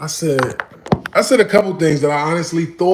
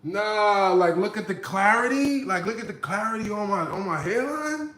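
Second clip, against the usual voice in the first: higher, indignant mock defence; imitating his own higher, playful denial; mock protest; 5 semitones higher than usual. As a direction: protest like your hairline is flawless. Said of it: "I was like".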